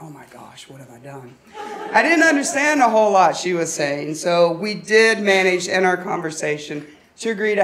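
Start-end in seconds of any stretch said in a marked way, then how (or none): 0.0-1.3 very low, hushed aside; embarrassed aside. Retold or imitated. Realising the caller is a stranger, hushed embarrassed aside. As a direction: drop far down, as if to yourself. Retold dawning embarrassment on the phone.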